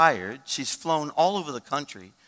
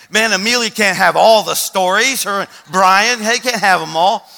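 loud, driving preacher's cadence; full-force preaching; 8 semitones higher, 14 dB louder than usual. Acted. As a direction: preach it hard to the congregation.

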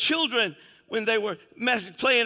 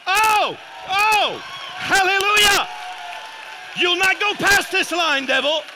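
full-force preaching; 7 semitones higher, 7 dB louder than usual. Real, not acted. Preacher telling the devil he'll not cross the line, shouted preaching.

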